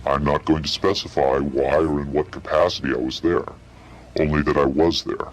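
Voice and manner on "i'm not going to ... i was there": ordinary talk, nothing marked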